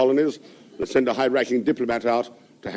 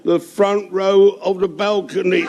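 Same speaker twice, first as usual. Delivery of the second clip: put-on slow drunken answer; 7 semitones higher, 4 dB louder, slower than usual.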